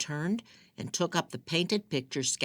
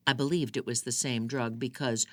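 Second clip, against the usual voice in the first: ordinary delivery, nothing marked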